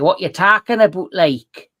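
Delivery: loud, fast broad Geordie retort; broad Geordie grandfather. Retold or imitated. Grandson asking grandfather to repeat, loud broad Geordie. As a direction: bark it quickly like an old Geordie grandad. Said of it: "And he's like".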